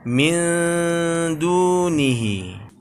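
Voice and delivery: slow min dunihi with a hummed n; slow recitation; blurred n. Shown as a demonstration. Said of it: "seperti yang tadi kita samarkan".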